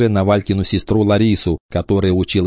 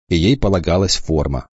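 ordinary delivery, nothing marked

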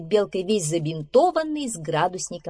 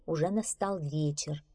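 ordinary delivery, nothing marked

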